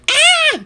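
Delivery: very high, loud "aah" scream; sudden high yelp of alarm; horrified yell. Acted. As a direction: shriek in mortified horror. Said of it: "yelled Andrew".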